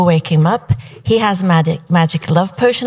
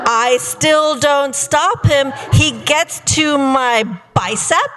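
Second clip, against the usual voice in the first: building swoon; 6 semitones higher than usual. Acted. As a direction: stretch it out, rising into mock rapture. Retelling escalating stage kisses, building rapture.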